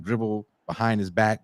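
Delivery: usual speaking voice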